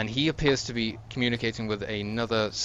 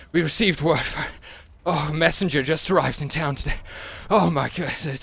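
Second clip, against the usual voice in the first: breathless, panicked messenger, higher and louder; out of breath; 7 semitones higher, 5 dB louder than usual. Acted. Young guard bursting in with news, breathless and panicked. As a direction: gasp it out in a rush of alarm. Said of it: "out of breath".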